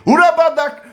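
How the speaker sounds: sudden high, loud cry in tongues; fervent tongues outburst. Real, not acted. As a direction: burst out in ecstatic, forceful syllables. Bursts into tongues mid-preaching. (brado fervoroso em línguas)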